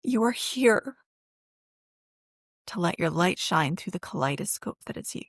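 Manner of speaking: moved, on the edge of tears; emotional. Real, not acted. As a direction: voice rising with real emotion, then settling. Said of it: "It makes me emotional".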